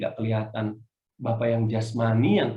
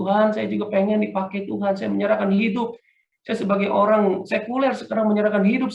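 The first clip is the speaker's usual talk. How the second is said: earnest prayer; 7 semitones higher, 5 dB louder than usual. Retold or imitated. Retold prayer in hotel.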